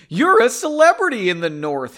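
loud, excited exclamation with arms flung wide; exclaiming. Acted. Henry to the lady after the strangers leave, loud and delighted. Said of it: "Henry exclaimed, arms wide".

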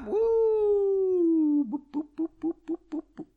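high-pitched woo then boops; cheer, then beep like a buzzer; mock beeping. Host marking the drinking break, high buzzer boops.

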